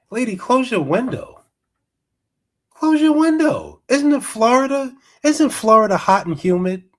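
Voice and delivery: high, loud, fed-up shouting; exasperated yell. Real, not acted. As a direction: yell at her to shut the window. Yelling at the Karen on screen.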